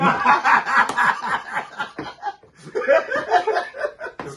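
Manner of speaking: long, high, loud laugh; real burst of laughter. Real, not acted. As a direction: crack up at the punchline. Laughing at wrong-team story.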